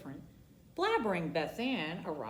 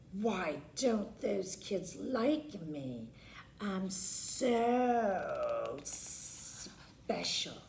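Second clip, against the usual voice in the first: sulky whisper; 4 dB quieter, much slower than usual. Acted. Sulking alone in her room.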